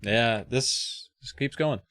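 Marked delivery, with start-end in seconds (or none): none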